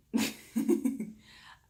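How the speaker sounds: loud, high burst of laughter; scoffing laugh. Real, not acted. Speaker told their speech is unbecoming, scoffing laugh.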